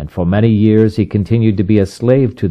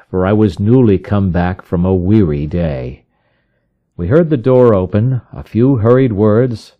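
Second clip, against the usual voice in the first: ordinary delivery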